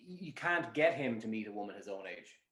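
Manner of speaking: ordinary talk, nothing marked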